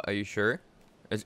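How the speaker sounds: usual speaking voice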